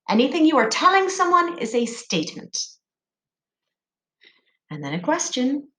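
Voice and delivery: normal talking voice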